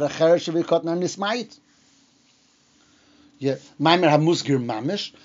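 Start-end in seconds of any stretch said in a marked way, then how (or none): none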